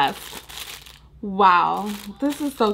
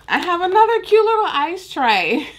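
higher and louder with glee; excited; 9 semitones higher, 6 dB louder than usual. Real, not acted. Showing viewers a new gift, excited squeal. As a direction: burst out with delight at the find.

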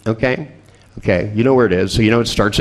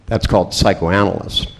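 ordinary talk, nothing marked

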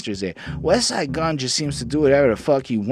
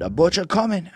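imitating the rapper's tag, pitched up; 7 semitones higher than usual. Quoting the rapper's signature tag, mock hype call. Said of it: "like his tag".

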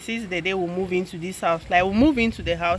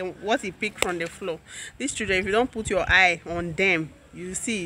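ordinary talk

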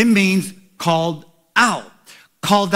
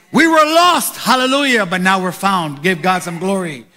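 rising, shouting preacher's cadence; preaching; 3 semitones higher, 4 dB louder than usual. Acted. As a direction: build to a roar for the church. Call and response praise.

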